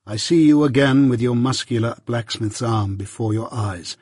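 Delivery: plain reading voice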